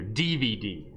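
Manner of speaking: "D V D" with both D letters stressed; stress on D's. Shown as a demonstration. Teacher repeating the normal emphasis, stressed D's. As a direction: punch each D in "D V D". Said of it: "The emphasis is here and here".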